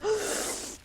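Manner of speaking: 1 soft gasp. Real, not acted. Bandages peeled off.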